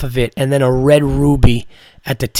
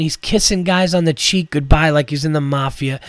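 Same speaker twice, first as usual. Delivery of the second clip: usual speaking voice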